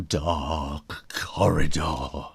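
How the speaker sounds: ordinary delivery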